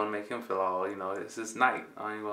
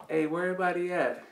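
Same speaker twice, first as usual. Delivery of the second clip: calling out; 9 semitones higher than usual. Retold or imitated. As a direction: shout for friends who aren't there. Calling out to empty street.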